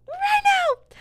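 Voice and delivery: very high, loud screech; over-the-top squealed demand; mock demanding shriek. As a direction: burst into a shrill comic yell. Demand repeated as a sudden outburst.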